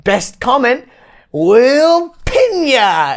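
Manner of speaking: high, hugely stretched Aussie announcement; imitating Australian hosts. Channel hosts promising to pin a comment, high drawn-out Australian accent. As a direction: draw out the catchphrase high in Australian. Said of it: "they're like".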